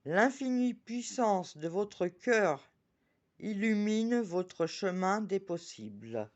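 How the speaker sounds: slow, solemn oracle reading; solemn card reading. Acted. Reading the oracle card aloud to viewers, slow and solemn. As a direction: read the message slowly and reverently.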